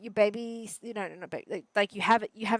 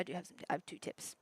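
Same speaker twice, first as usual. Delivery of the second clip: whispered aside; 11 dB quieter than usual. Real, not acted. Unvoiced aside while gaming.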